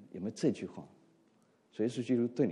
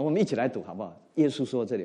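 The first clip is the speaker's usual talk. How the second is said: ordinary delivery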